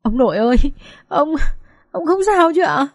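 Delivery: tearful voice climbing high; choked sobbing. Acted. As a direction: choke out a worried question to grandfather. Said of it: "My nói trong tiếng nức nghẹn ngào".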